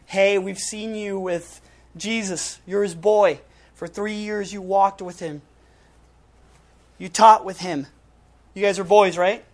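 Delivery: loud, accusing crowd calling Peter out; accusing bystanders. Retold or imitated. Bystanders confront Peter with a loud accusation. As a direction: bark the accusation like a hostile onlooker.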